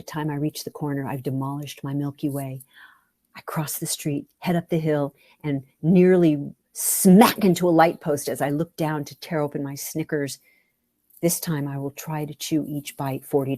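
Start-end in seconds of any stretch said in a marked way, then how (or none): none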